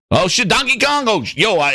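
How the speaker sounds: ordinary talk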